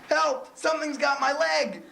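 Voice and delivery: high panicked yelling; panicked cry for help. Acted. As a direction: scream for help as something drags you off. Reporter attacked on air.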